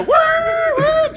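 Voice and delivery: high honking woo; car horn imitation. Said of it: "cars behind go".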